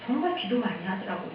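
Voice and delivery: ordinary delivery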